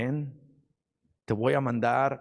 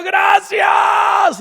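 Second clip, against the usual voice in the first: very loud, high, drawn-out cry; shouted thanks; 14 dB louder than usual. Acted. Healed leper running up to Jesus, shouting thanks. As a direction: shout it out with all your might. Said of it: "llegó gritando y corriendo y diciendo".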